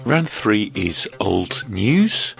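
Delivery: normal talking voice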